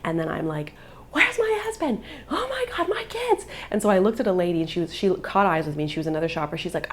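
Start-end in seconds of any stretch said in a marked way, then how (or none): 1.1-3.4 very high mock shriek, volume held back; high frantic cry, contained; imitated panicked scream. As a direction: re-enact a mother panicking for her kids. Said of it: "I'm screaming".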